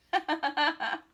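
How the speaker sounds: loud run of ha-ha; amused laugh. Real, not acted. Narrator amused as all laugh, hearty laugh.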